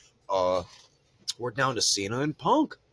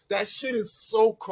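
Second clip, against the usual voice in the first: ordinary delivery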